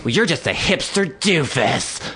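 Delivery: high-pitched insulting mimicry; mocking imitation. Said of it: "he was calling me a hipster doofus".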